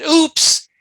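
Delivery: loud, high-pitched oops; retold oops. Storyteller repeating the surgeon's oops, loud exclamation. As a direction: blurt a big mock oops.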